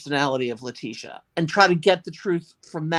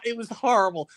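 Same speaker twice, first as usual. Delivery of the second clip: ordinary talk, nothing marked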